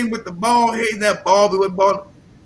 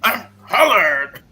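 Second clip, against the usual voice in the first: slow, proud correction; mock haughty insistence; slower than usual. Answering a confused questioner, slow and haughty. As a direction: insist on the label with wounded pride. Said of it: "You what?".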